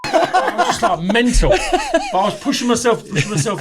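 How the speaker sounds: high, loud laughing through the words. Real, not acted. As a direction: laugh it out, unable to stay serious. Laughing at his own madness.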